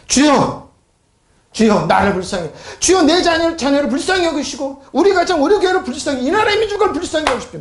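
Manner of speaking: high, pleading prayer cry. Shown as a demonstration. Preacher shows congregation how to beg God's mercy, crying out. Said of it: "큰 소리 칠 때".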